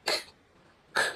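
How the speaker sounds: "k" as a bare voiceless click of sound; isolated k sound. Shown as a demonstration. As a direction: release a lone "k" with no vowel. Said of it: "the Q U is pronounced together as".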